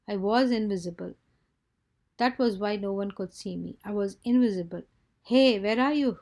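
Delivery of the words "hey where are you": loud, higher-pitched searching call. A narrator's reading. Friend searching for invisible Hubert, calling out. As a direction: call out for someone you can't see.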